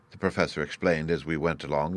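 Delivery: plain reading voice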